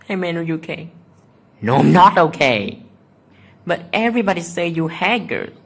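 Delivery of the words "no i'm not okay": loud frustrated reply. Real, not acted. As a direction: push it out loudly. Answering a taunting comment.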